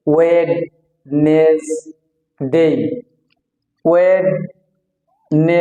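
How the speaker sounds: ওয়েডনেসডে slow, "wed ... nes ... dey", d sounded; three-syllable mispronunciation. Shown as a demonstration. Teacher showing how many of us split it, three-syllable mispronunciation. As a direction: break ওয়েডনেসডে into three separate beats. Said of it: "তিনটা সিলেবালে ভাগ করে বলি".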